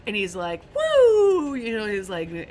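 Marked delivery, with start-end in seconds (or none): 0.7-1.5 long high, loud whoop; excited whoop. Retold or imitated. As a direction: imitate an overjoyed, drawn-out woo. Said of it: "just going crazy about how he survived".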